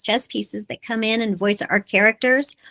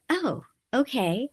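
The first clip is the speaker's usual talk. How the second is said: stunned reply; 4 semitones higher, 6 dB quieter, slower than usual. Retold or imitated.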